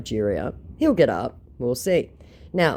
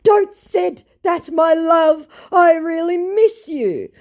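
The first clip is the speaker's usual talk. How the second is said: high, loud, slow mock pleading; lovesick scammer; 8 dB louder, slower than usual. Scammer tells her he misses her, mock lovesick pleading. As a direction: plead in a hammed-up, drawn-out voice. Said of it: "To which he says".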